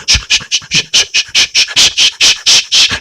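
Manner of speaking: rhythmic voiceless shushing, skate strokes; a string of hissed swishes; imitated skating swishes. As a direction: mimic skate blades with quick whooshes. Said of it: "스케이팅 같은 거 뛰려고 준비하고 있죠".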